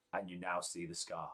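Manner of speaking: ordinary talk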